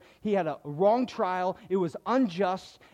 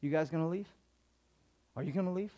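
gentle question; 3 semitones lower, 7 dB quieter, much faster than usual. Retold or imitated. Jesus asking the twelve.

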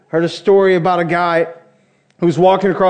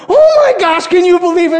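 much higher and louder, squealing with delight; thrilled disbelief; 11 semitones higher, 5 dB louder than usual. Retold or imitated. Fan at home yelling to his wife after the home run, excited high shout. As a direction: shriek it like your team just scored. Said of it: "and I'm like".

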